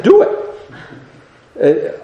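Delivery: put-on clipped, breathy command to a child; mock-strict grandpa, short and firm; stern order. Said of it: "I said".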